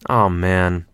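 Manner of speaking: ordinary delivery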